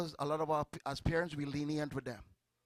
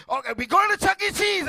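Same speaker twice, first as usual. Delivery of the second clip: high, loud cheerful promise; excited promise; 13 semitones higher, 10 dB louder than usual. Acted. Parent promising children a trip to Chuck E. Cheese excitedly. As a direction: sell the treat to the kids.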